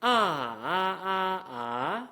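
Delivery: sketch the tones on bare "ah"; tone contour demo. Shown as a demonstration. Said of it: "Both of these require a reset".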